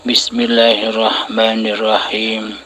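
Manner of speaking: ordinary talk, nothing marked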